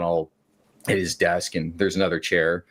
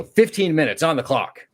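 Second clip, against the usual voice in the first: taunting call-out; 7 semitones higher than usual. Retold or imitated. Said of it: "I just slap it and go".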